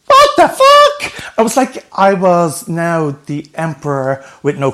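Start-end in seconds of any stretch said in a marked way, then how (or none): 0.1-1.1 much higher and louder, slow, disbelieving; stunned outburst. Retold or imitated. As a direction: let the shock burst out once the door shuts. Shocked outside the door.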